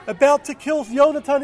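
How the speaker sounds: plain reading voice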